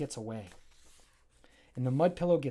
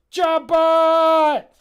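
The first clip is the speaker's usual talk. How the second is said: loud high shout of a name; calling out; 12 semitones higher, 13 dB louder than usual. Acted. Jammer calls Jobbot into the fight, loud high shout. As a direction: yell for the robot to come.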